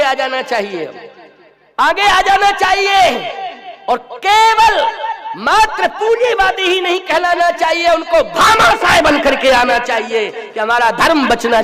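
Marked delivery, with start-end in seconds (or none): 1.8-3.4 high, loud, drawn-out preacher's call; slow ringing shout, urging people forward; forceful exhortation. Real, not acted. Preacher calling the rich to step forward, forceful exhortation. 3.9-11.6 loud, high oratory; full-force preaching. Acted. Preacher urging capitalists to become Bhamashah, full-force preaching.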